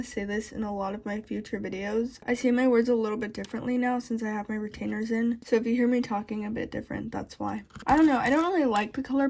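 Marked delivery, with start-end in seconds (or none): none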